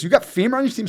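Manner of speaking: high, loud and thrilled asking; excited question. Retold or imitated. Coffey asking coaches about his old friend, high thrilled question. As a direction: pitch it up with delighted disbelief. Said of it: "He goes".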